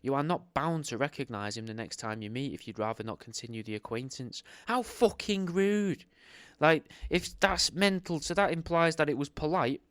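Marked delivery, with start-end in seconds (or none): 4.7-6.0 high, slow, drawn-out indignation; high, slow mock-shocked gasp of outrage. Real, not acted. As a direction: stretch it out, scandalised. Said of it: "outraged reaction".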